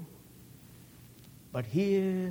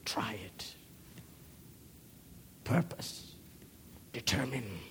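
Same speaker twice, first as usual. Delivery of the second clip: much softer, breathed urging; a hushed challenge; whisper; 3 semitones lower, 9 dB quieter than usual. Real, not acted. Preacher urging the congregation, whisper.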